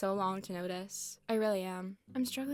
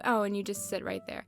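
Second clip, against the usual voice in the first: usual speaking voice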